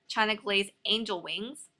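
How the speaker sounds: ordinary talk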